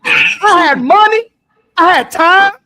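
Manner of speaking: loud, high-pitched indignant rant; impassioned complaint. Acted. Man airing his grievance to an ex, impassioned. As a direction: belt out the grievance high and hard.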